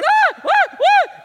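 high, loud terrified screams; repeated screams. Retold or imitated. Waking from the dream, repeated high screams. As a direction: scream yourself awake.

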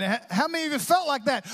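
ordinary talk

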